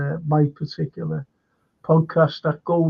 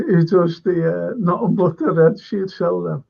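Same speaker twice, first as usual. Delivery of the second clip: ordinary delivery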